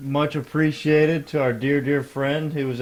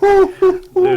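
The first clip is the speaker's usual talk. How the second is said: very high, loud excited whoop; a wild, squealing whoo; Crackhead John voice; 12 dB louder, slower than usual. Acted. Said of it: "Crackhead John impersonation".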